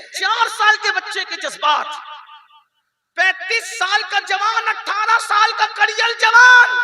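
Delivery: building into loud, high, impassioned preaching; fiery preaching. Acted. Preacher rousing the women of the congregation, loud fiery preaching. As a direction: thunder the rebuke at the congregation.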